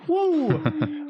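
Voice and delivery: high whooping cheer; excited whoop. Real, not acted. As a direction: let out a big woo. Hyping the show opening.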